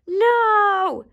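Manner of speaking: high, loud, drawn-out refusal; little pig's high yell; crying out. A narrator's reading. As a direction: cry it out like a little storybook pig. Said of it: "cried the first little pig".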